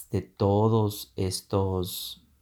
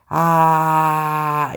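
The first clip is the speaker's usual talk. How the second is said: "ah" loud and higher, held long; 7 semitones higher, 7 dB louder than usual. Shown as a demonstration. Teacher demos the strong start of the drill, a loud "ah". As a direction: belt the "ah" strong. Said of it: "usted comienza bien alto, o sea, bien fuerte".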